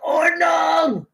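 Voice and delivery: high, loud first Ordnung then dropping; mock old man yell. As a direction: bark it like a grumpy grandpa at a parked car. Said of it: "And you go".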